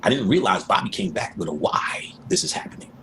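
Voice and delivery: ordinary delivery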